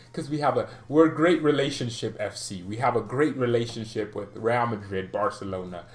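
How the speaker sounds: usual speaking voice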